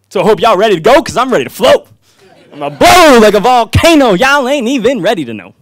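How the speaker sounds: loud, high, booming poem opener; spoken-word poem. Real, not acted. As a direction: belt out the first rhymes like a hype performer. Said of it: "I am gonna give a poem".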